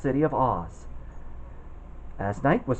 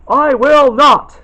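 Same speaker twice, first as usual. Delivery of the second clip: loud, high, slow defiant refusal; brave refusal; 12 semitones higher, 14 dB louder, much slower than usual. Acted. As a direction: stand up to the witch, slow and loud. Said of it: "he said it in a very brave way".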